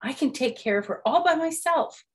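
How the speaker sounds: put-on pleading child frog voice; eager kid frog. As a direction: beg to do it yourself. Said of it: "said Froggy".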